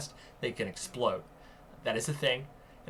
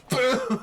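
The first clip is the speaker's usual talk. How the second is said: loud, high "Boom!" like a blast; explosion sound effect; 12 semitones higher, 9 dB louder than usual. Retold or imitated. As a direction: yell boom like something blowing up. Mimicking battery explosion.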